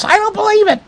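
very high-pitched mock wail; cried disbelief. A narrator's reading. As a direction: cry it out in hammed-up denial. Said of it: "cried Boris".

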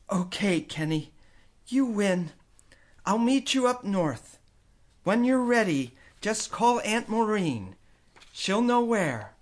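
a mother conceding, slowing at the end; mother giving in. A narrator's reading. Mom voiced in story.

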